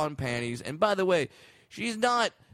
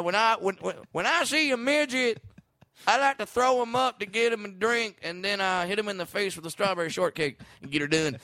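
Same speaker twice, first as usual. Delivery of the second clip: high-pitched country-comic impression; Larry the Cable Guy; 6 semitones higher than usual. Comic spinning a mock one-liner, country-comic impression. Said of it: "is this Dave Chappelle or Larry the Cable Guy".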